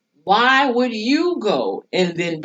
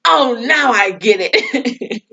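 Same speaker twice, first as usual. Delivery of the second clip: slow, laugh-filled realisation; savouring the joke through laughter; 3 semitones higher, slower than usual. Real, not acted. Vlogger laughing at the wife's revenge question, amused.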